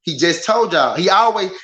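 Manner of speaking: ordinary talk, nothing marked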